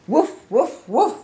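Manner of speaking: high, loud woofs; dog barking. Acted. Big mean dog barks at Piggy, high loud barking. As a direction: bark it out in sharp bursts. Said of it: "barking, saying".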